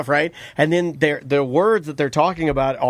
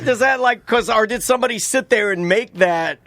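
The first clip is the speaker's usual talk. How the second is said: incredulous; 7 semitones higher than usual. Retold or imitated. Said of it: "And I'm like".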